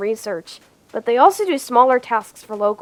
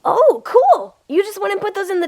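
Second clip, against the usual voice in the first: excited; 7 semitones higher than usual. Real, not acted. Surprised at planted potatoes.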